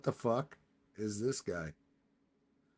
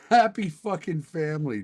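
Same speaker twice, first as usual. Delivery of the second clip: higher, louder mocking "happy fucking family"; sarcastic mockery; 4 semitones higher, 6 dB louder than usual. Real, not acted. Streamer mocking a family photo, higher sarcastic sneer. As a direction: sneer at the picture while half laughing.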